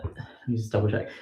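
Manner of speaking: ordinary delivery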